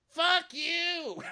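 hammed-up squealed curse; imitated distant yelling. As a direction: screech it up high like someone yelling from the back. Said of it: "She's in the back yelling".

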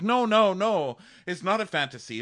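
high, insistent mock protest. Vanessa insisting to mom it is real, mock protest.

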